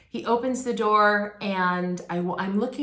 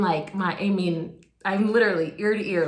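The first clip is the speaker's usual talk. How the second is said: ordinary talk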